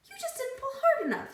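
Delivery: high, squeaky talking pigtail. A narrator's reading. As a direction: play the cheeky pigtail, way up high.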